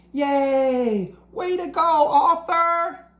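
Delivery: put-on high, excited kids' cheer; class cheering. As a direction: cheer like a proud classroom of kids. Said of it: "Everyone in Mister Ratburn's class cheered".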